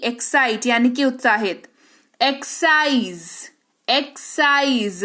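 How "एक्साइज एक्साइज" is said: slow "एक्साइज" with the s-sound brought out after the k; audible S after X. Shown as a demonstration. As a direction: say "एक्साइज" as "ek-saiz", stretched. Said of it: "स सुनाई दे रहा है".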